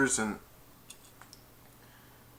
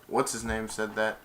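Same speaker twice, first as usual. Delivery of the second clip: ordinary delivery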